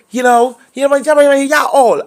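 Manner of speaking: high put-on coach voice; imitating Rosie. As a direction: mimic the coach's catchphrase. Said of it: "when Rosie coach say".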